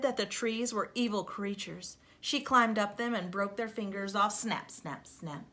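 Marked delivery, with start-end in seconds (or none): 4.3-5.4 softer, lower sound-effect snaps; quiet snaps. A narrator's reading. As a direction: click out the snaps softly. Sound effect of breaking fingers.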